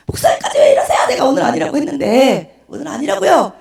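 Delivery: loud, high wailing complaint; mock sobbing outburst; imitated tearful protest. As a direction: protest at full volume, as if bawling. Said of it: "제가 막 엉엉 울면서".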